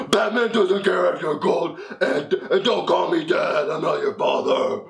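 dad in a comic superhero voice; hammed-up hero voice, stern act; Batman. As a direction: disown your child as a mock vigilante. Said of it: "He pretends to be Batman".

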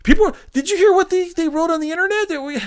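high-pitched put-on fretting; mock panic. Studio reacting to internet complaints, high mock panic.

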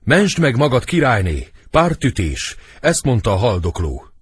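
high, loud cry of alarm at first; offstage warning shout. Acted. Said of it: "Még kívül kiált".

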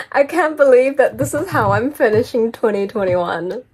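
loud, thrilled exclamation; excited disbelief. Real, not acted. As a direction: say it at full volume, thrilled. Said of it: "I'm just, like, really excited".

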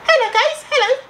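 very high, squeaky falsetto. Acted. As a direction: put on a tiny squeaky mock voice. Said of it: "If you got a squeaky voice".

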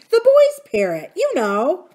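aunt voice. A narrator's reading. Aunt Polly explaining.

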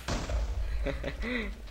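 airless giggle; breathy laugh. Real, not acted. Gamer laughs at the chaos, breathy giggle. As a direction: laughs under his breath.

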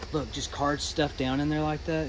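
normal talking voice

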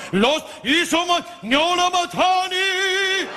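put-on high, very slow foreign accent; foreign street seller. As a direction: stretch it like a foreign street vendor. Rose seller's broken Italian.

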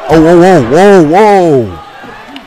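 loud, startled whoas; stunned whoa. Real, not acted. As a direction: react as if you can't believe it. Stunned by the brawl.

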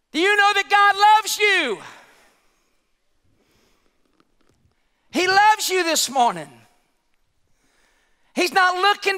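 high, driving sermon delivery; impassioned preaching. Acted. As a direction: press every phrase home to the congregation. Preaching with rising force.

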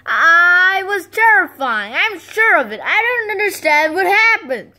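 loud, high indignant whine; upset. Acted. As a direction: complain in disbelief. Frustrated complaint.

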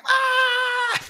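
a sudden high, loud joyful yelp; excited shout. Retold or imitated. Stoic businessmen exiting the VR, ecstatic high yell. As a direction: burst out like a thrilled kid on a ride. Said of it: "Just, like, so excited".

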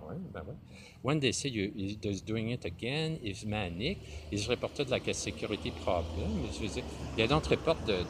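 ordinary delivery

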